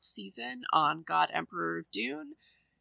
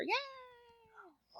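sudden high little cheer; high squeaky yay; 9 dB quieter than usual. Real, not acted. Guest cheering about reviewing Hellraiser, high squeal. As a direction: squeak it out, thrilled.

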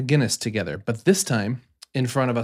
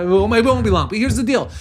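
excited; 9 semitones higher, 4 dB louder, faster than usual. Real, not acted. Defending the ramble, pitched up.

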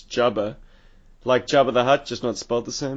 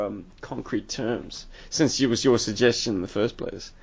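ordinary talk

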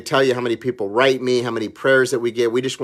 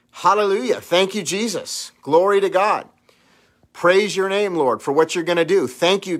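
raised, fervent praying voice; fervent prayer; 4 semitones higher than usual. Acted. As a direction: pray boldly, lifted high.

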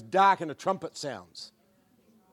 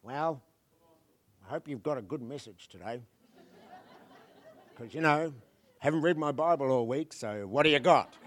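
lightly voiced casual consumer churchgoer; a hint of a bored pew-sitter asking for entertainment; mock churchgoer, softer and offhand; lazy churchgoer; 5 semitones lower, 4 dB quieter than usual. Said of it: "coming to church to just listen to a good message".

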